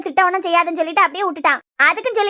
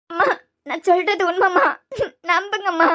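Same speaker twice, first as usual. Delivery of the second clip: tearful pleading. Acted. Daughter pleading with disbelieving mother, tearful pleading. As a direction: beg to be believed through tears. Crying girl begs to be believed.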